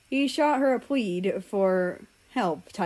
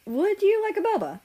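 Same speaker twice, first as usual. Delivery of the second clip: high friendly voice; 13 semitones higher, 4 dB louder than usual. Acted. Friend offers boba in dialogue.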